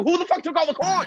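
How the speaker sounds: high, fast outraged demand; comic outrage. Acted. Comic asking about missing coins, mock outrage.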